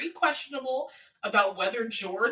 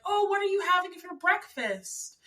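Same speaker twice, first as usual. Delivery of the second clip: higher-pitched quoted question; imitating a boyfriend; 8 semitones higher than usual. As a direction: mimic him asking brightly high. Voicing man asking his girlfriend.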